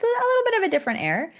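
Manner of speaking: mock posh. Mocking her friend's posh family.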